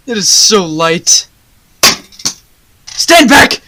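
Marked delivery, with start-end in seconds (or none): 2.9-3.6 loud, urgent shout to get clear; alarmed warning shout. Acted. Baker warning everyone as the floor gives way, shouting. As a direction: yell a warning as the floor collapses.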